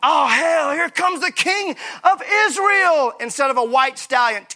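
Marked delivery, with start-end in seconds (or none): 0.0-3.1 high, slow sarcastic groan; mock unimpressed onlooker. Pastor imagining a cartoon bystander's high, drawn-out sarcasm. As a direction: play the letdown bystander in a cartoon. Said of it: "I see this in animation".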